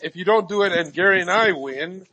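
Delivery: ordinary talk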